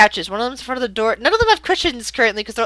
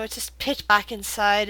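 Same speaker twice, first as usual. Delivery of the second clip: ordinary talk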